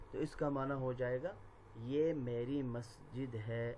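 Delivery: usual speaking voice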